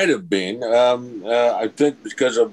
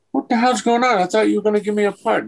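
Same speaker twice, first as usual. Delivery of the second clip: much higher, exasperated demand; indignant outburst; 13 semitones higher, faster than usual. Retold or imitated. Actor confronting the producers over a promised part, higher and exasperated. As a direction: call them out for the broken promise.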